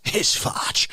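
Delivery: ordinary delivery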